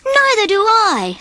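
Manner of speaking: sulking. A narrator's reading. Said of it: "sulked Morty".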